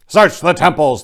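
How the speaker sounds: command. Acted. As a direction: order the men like a captain. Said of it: "Theron commanded".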